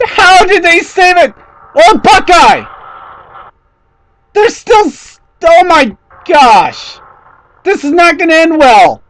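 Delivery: can't believe what you're seeing; stunned excitement. Real, not acted. Cars saving a wreck live.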